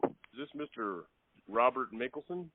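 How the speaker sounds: ordinary talk, nothing marked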